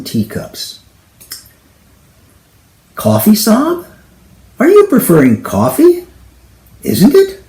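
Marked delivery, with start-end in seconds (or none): 3.0-7.4 put-on high, loud Indian accent, cheery; Indian tea seller. Indian tea seller at the door offering coffee, Indian accent. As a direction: offer coffee in a bright Indian sing-song. Chaiwala voiced again.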